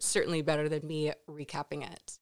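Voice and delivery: ordinary delivery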